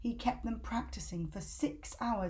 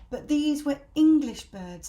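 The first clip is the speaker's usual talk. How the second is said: plain reading voice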